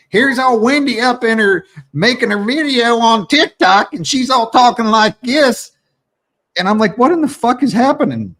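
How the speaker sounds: high, animated teasing rant; excited teasing. Real, not acted. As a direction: pitch pushed up high while mocking a friend's accent. Teasing friend's hillbilly video.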